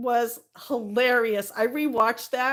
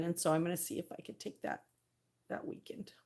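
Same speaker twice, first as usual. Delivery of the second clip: whisper; 4 semitones lower, 14 dB quieter, faster than usual. Real, not acted. Secret plan for the weekend.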